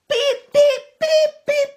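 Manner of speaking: high loud beep, beep, beep, beep; silly feet sounds. Acted. Reading Tufty's big flappy feet, high loud beeps. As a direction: honk the footsteps like a toy.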